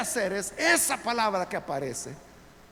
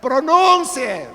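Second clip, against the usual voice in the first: loud, higher, drawn-out command; exasperated shouted urging; 4 semitones higher, 13 dB louder, much slower than usual. Retold or imitated. Preacher acting out urging a praying person, exasperated drawn-out shout. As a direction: cut in with a long, loud plea.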